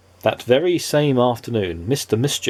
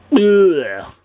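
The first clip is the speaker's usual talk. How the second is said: disgusted ugh; 8 semitones higher, 5 dB louder than usual. A narrator's reading. Greedy bites the mud cake.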